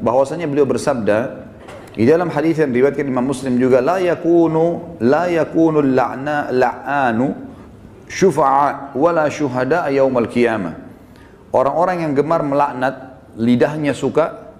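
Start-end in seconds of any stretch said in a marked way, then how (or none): 3.8-10.7 very slow, deliberate Arabic recitation; Arabic hadith recitation. Real, not acted. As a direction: recite haltingly, drawing out each long vowel.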